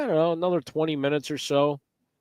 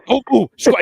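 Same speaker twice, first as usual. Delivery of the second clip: urgent warning; 9 semitones higher, 10 dB louder than usual. Retold or imitated. Frank grabbing him, urgent warning.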